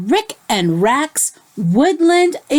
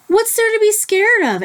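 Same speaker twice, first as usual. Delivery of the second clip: yawning; 11 semitones higher than usual. A narrator's reading. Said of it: "asked Rick with a yawn".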